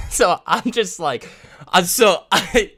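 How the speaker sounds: giggling through false starts; laughing. Real, not acted. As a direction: try to talk while cracking up. Giggles while retelling his comeback.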